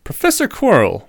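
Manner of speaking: grave. A narrator's reading. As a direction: address the teacher with solemn weight. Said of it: "said Harry gravely".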